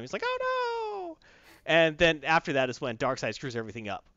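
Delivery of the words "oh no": very high, squeaky cry of alarm; panicked squeal. Retold or imitated. Superman realizing Prince Adam has him, squealing. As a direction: shriek it like a cartoon villain caught out.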